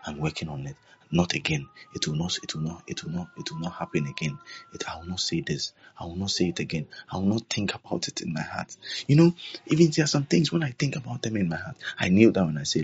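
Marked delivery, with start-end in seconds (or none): 0.1-8.8 low, hushed, repentant promises; acted meek, soft, lowered reply; submissive prayer. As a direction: murmur it humbly, like answering a correction from God. Acting a contrite reply to God.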